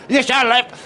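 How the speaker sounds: high, loud impatient yell at a pupil; fed-up dance teacher shouting; exasperated scream. Retold or imitated. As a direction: lose patience with the student. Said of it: "And I'm screaming".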